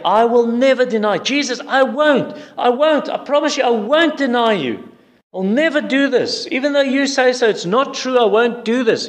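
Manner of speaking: high-pitched, emphatic protesting; acting out zealous, repeated denial; vehement insistence. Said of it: "Peter said emphatically, so it's with great vehemence".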